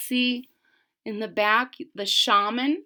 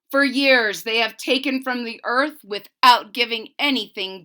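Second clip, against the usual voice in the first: louder, grave proclamation; solemn shaman; 4 semitones higher, 10 dB louder than usual. Acted. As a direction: proclaim it to the gathered tribe. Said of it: "he said".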